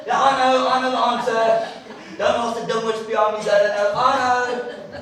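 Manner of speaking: high, loud know-it-all pupil; mock keen schoolgirl; goody two shoes. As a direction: show off to the teacher. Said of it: "goody two shoes like my sister".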